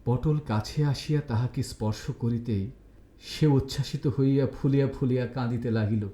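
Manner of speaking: usual speaking voice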